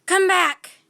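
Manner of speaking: captain yelling at deserting pirates; shouted. A narrator's reading. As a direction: yell after the fleeing crew. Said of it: "shouted Captain Bones".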